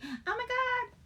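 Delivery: high-pitched thrilled squeal, replayed; excited squeal. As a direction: squeal it like you just spotted Olaf. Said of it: "would cause me to be".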